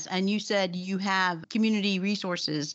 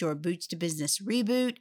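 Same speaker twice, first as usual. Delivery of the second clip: ordinary delivery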